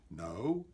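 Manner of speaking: "no" dropped low and flat; a firm, low final "no". Shown as a demonstration. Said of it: "Say it in all the different ways you want to say it".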